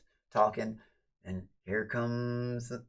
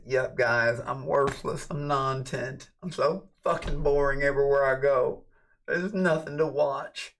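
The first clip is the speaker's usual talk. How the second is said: raised, louder sarcastic rant; sarcastic self-mockery; 5 semitones higher, 6 dB louder than usual. Streamer echoing critics to his viewers, sarcastic rant. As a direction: mockingly agree with the critics, pitched up and emphatic.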